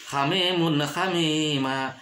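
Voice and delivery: slow "hamimun hamima" with a clean n; no hum after "hamimun"; "hamimun hamima", long vowels and a clear n; clear idhar reading. Shown as a demonstration. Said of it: "tidak boleh dibaca".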